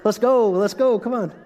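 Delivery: rally them like a coach; coach hype. Retold or imitated. Pastor imitating a coach urging the class, hyped. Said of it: "I'm just like".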